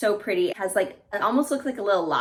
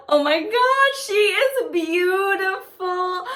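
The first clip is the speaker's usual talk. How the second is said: high, loud, drawn-out gush; slow, high, awestruck squeal; overwhelmed delight; 9 semitones higher, 5 dB louder, slower than usual. Real, not acted. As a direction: wail with joy at how lovely it is. First sight of the shoe.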